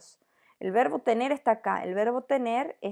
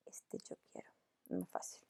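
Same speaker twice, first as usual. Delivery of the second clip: whispered aside; 20 dB quieter than usual. Real, not acted. Muttering to herself while searching.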